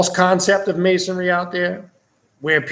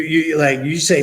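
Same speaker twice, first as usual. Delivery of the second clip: usual speaking voice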